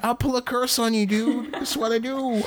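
high fast laid-back dude voice; Owen Wilson impression. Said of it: "He's secretly a witch".

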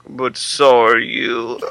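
slow, drawn-out one-liner; slow put-on character voice; Toulon. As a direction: mimic a puppeteer savoring his quip. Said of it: "he's like".

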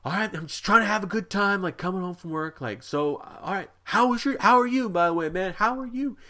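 put-on high, slurred friendly drunk voice; drunk man. Drunk man greets the young guy, high and slurred. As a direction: play the drunk man trying to be chummy. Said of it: "the guy's like".

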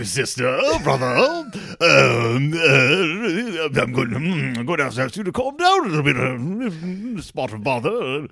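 stuffy British Papa Bear; prim British gentleman voice; British accent. Retold or imitated. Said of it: "like, British accent".

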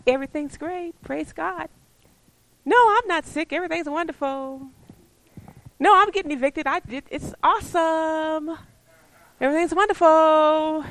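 high, sing-song fake positivity; hammed-up mock believer denying all trouble; little robot. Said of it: "like a little robot and just say".

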